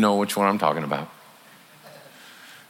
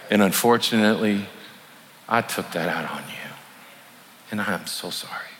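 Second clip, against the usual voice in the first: soft, lower, tender apology to a spouse; humble apology; 4 semitones lower, 4 dB quieter than usual. Shown as a demonstration. Husband apologizing to his wife after a hard day, soft and tender. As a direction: apologize gently and sincerely, quiet. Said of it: "Humbly go in and apologize".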